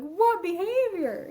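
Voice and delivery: high, slow disbelief; incredulous question. Retold or imitated. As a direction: stretch it out, baffled. Her baffled reply to boss.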